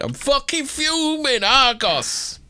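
high, slow, exaggerated complaint; mock fuming shopper. Mocking an angry Argos customer, drawn out. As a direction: stretch it out like an outraged customer. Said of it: "I'll be".

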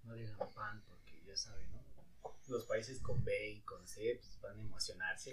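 very soft, low, secretive warning; hushed aside. Retold or imitated. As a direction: drop the voice like sharing a secret. Joke about hearing, very softly said. (en voz muy baja, como secreto)

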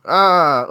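loud, drawn-out "ah"; mock groan. Man imagining a giant stone hand fumbling a pencil, mock groan. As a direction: cry out as if the hand won't cooperate.

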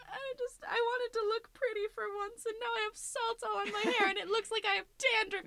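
high, teary mock sob over ruined hair; mock crying whine. Friend retelling her ruined hair, whining in a high mock sob. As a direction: whimper it like a hammed-up crybaby. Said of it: "pretty upset 'cause I was like".

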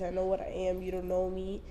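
ordinary talk

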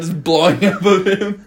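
normal talking voice

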